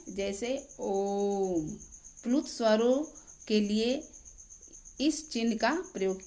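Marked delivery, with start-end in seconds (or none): 0.8-1.7 ओम with a very long held ओ; prolonged vowel. Shown as a demonstration. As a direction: stretch the ओ in ओम to triple length before closing on म. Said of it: "तिगुना समय लगता है". (ओम का ओ तिगुना लंबा खींचकर बोलें)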